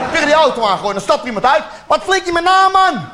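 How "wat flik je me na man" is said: high, indignant yell at the stone thrower; mock outraged motorist; angry driver. (boze automobilist)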